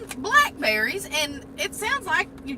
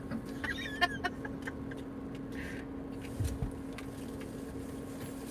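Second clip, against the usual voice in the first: laughing; 12 dB quieter than usual. Real, not acted. Long laugh at crunching.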